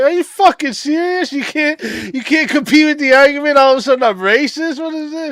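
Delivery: outraged disbelief. Retold or imitated. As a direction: blow up in disbelief at the accusation. Retold outraged reply on panel.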